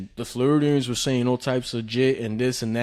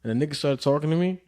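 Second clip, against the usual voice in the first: normal talking voice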